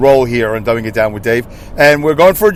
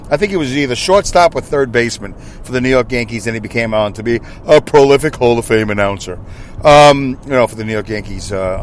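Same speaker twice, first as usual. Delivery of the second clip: ordinary talk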